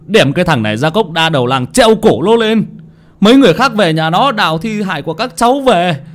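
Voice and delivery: high, raging demand; furious father. Acted. Angry father urging villagers to hang Hoạch, high and raging. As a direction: curse him and demand the hanging.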